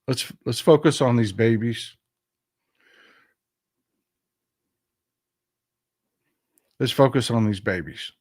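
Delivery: a touch of tenderness, falling away at the ends; choked up, mushy. Real, not acted. Host pleading to focus on these babies, choked up. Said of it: "don't mean to get so mushy".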